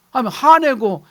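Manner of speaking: ordinary talk, nothing marked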